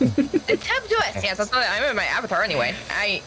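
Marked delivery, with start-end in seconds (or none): 0.0-1.2 put-on slow, high baby-talk voice; cutesy, drawn-out chibi voice, pitched way up; chibi Idris voice. Confirming the character's nickname to friends, cutesy chibi voice. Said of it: "I like the Chibi Idris voice".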